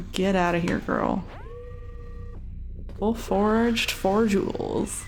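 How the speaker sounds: ordinary delivery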